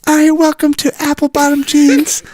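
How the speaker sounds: high, put-on hostess voice; English stripper. Stripper welcoming customers to the club, high English voice. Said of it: "I'm just an English stripper".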